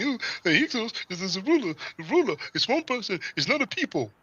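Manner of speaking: high, mocking imitation of the opponent. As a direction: pitch it up to mock his claim. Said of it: "but he kept saying".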